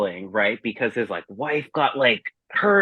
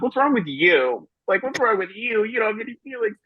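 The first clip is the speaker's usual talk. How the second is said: climbing much higher with mock outrage; mock-outraged retort to unmoved friends; indignant comeback; 10 semitones higher than usual. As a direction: throw it back at them, incredulous. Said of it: "And I was like".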